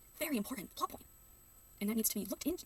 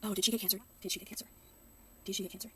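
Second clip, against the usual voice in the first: ordinary talk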